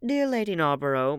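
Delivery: plain reading voice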